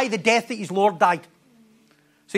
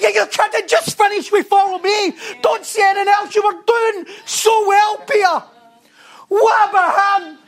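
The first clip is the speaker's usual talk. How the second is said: exasperated; 9 semitones higher, 3 dB louder than usual. Retold or imitated. Preacher scolding Peter in mock exasperation, high and loud. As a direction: plead with Peter in disbelief.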